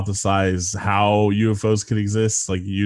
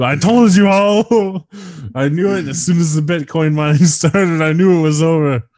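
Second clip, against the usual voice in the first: laughing I told you; 7 semitones higher, 6 dB louder than usual. Real, not acted. To the co-host about the doomed world, laughing triumph. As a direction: crow with laughter through the words.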